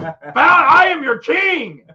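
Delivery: slow, high, loud command; mock-tyrant roar; hammed-up king. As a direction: bellow it as a pompous ruler. King commanding his subjects.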